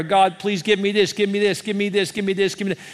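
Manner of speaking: mock insistent pleading, run together; repetitive begging. Preacher imitates Hannah praying the same prayer, repetitive begging. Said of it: "praying the same prayer".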